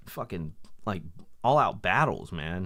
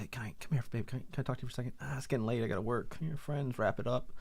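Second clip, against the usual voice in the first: put-on softer, hushed ask to the boyfriend; polite quiet request; 2 semitones higher, 8 dB quieter, faster than usual. Girlfriend pulling her man aside, quiet polite ask. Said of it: "come out and say".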